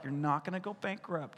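God calming a panicking believer; calm reassurance. Retold or imitated. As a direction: settle them gently. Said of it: "He's like".

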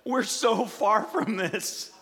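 slow, high, loud wail of the Israelites; mock weeping. Israelites hearing the law, imitated slow loud wail. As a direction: imitate a crowd sobbing over the law, drawn out. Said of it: "everybody just started weeping because they were like".

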